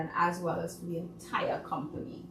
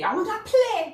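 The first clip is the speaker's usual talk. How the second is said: squeaky, much higher mock voice, louder; imitating Christine asking to play; high childlike plea; 13 semitones higher, 5 dB louder than usual. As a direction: beg like an eager little kid. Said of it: "Miss Christine say".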